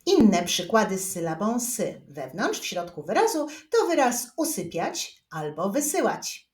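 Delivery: normal talking voice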